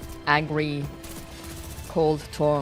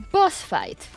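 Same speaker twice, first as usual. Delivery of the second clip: excited; 12 semitones higher, 6 dB louder than usual. Real, not acted. Boss fight appears on screen.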